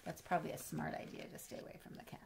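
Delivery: quick hushed aside; whisper. Acted. Telling the child a secret about the cat, whispering.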